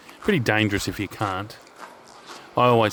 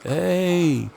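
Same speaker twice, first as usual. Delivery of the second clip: imitated far-off call, much higher and a bit louder, drawn out slowly; 11 semitones higher, 4 dB louder, much slower than usual. Cobra calling from the flooded drain to his friends, an imitated drawn-out high call. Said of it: "he's calling out".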